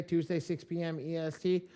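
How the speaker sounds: ordinary talk, nothing marked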